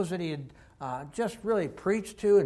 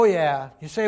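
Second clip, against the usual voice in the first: normal talking voice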